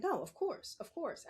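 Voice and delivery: light imitation of her son agreeing; lightly mimicked son, high then dropping; Matthew. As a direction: lift the pitch, agree readily like her son. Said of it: "That's how he talks".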